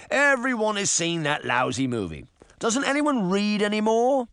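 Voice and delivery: exasperated pirate, climbing to a high question; scowling. A narrator's reading. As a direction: complain with a scowl in the voice. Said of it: "the pirate asked with a scowl".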